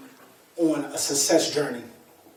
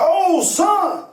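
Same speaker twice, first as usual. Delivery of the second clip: high, louder mock fatherly greeting; imitating his father; 12 semitones higher, 4 dB louder than usual. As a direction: play the dad greeting his son a little too sweetly. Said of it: "he was like".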